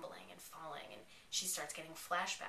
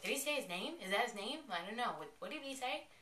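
higher, puzzled rising questions; lightly acted bewilderment, pitched up; retold confusion; 5 semitones higher than usual. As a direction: act out baffled viewer questions. Acts out own confusion on hearing it.

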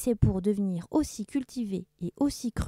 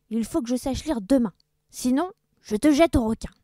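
louder, rising menace; pirate threat; 5 semitones higher, 8 dB louder than usual. Acted. Blackbeard menaces the schoolmaster, loud threat. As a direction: threaten him with the sharks.

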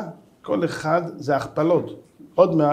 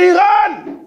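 high, loud cry of Iran; panicked philosopher shouting the threat; alarmed shout; 12 semitones higher, 7 dB louder, much slower than usual. Retold or imitated. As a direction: blurt the name in alarm.

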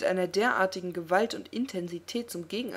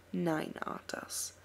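quiet slow menace; 5 dB quieter, much slower than usual. Acted. Elf taunting Arthas, quiet and slow.

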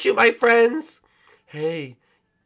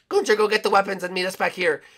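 ordinary talk

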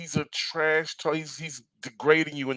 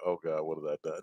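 much lower, much softer, laughing; mock despair; 9 semitones lower, 11 dB quieter, faster than usual. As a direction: moan it like a beaten man, through a laugh. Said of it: "Cena's selling like".

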